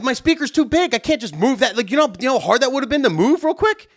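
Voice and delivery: high-pitched indignant protest; worked up, voice pushed high; getting mad. Retold or imitated. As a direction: argue back in exasperation. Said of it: "Now I'm getting more mad".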